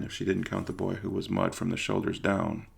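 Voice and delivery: usual speaking voice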